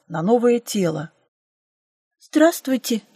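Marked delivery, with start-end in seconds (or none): 2.2-3.0 small higher-pitched squeak; mild startled squeak; squeaked greeting. A narrator's reading. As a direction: squeak out a hello in sudden fright. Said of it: "пискнула я".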